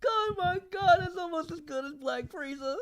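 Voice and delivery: high, soft, wrung out from laughing; helpless laughter. Real, not acted. Can't stop laughing at the friend's story, high weak voice. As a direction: squeak it out weakly after a laughing fit. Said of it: "I can't stop laughing".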